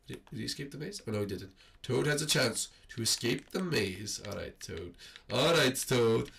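normal talking voice

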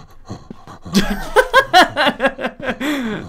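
loud high cackle. Real, not acted. Laughing at the NPC.

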